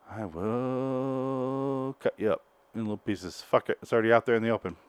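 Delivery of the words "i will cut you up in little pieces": slow, soft creepy threat; a hint of a horror killer; creepy villain voice.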